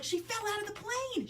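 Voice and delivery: put-on high little girl's wail of She fell out of the plane; child voice, first take. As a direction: say it high like an upset four-year-old. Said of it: "And she's like".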